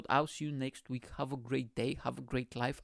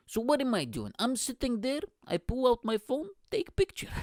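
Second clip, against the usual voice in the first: high-pitched comic dad, climbing higher; imitated dad; 10 semitones higher than usual. Dad explaining his sneaky photo to his child, high imitated voice. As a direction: act dad out, pitch rising to a squeak.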